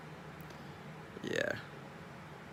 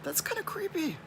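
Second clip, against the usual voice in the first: much higher, put-on squeaky voice; mock creeped-out reply. Answering followers who fall asleep to his voice, high mock squeak. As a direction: squeak it out, teasing. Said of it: "And I'm like".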